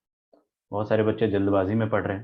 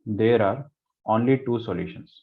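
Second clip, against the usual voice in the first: slow dictation; much slower than usual. Shown as a demonstration. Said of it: "dictating the question".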